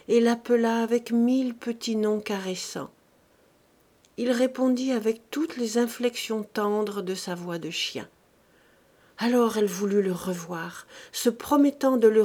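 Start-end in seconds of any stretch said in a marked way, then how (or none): none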